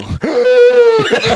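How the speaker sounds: very high, loud faked asthma fit; fake asthma attack. Telling his buddy's dread come true, faked asthma attack. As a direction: pretend you can't breathe, loud and high. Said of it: "I'm gonna have an asthma attack".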